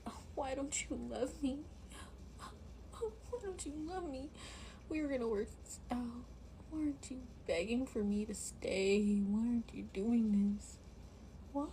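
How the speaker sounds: high, crying beg; mock sobbing plea. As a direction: sob the plea, hammed up.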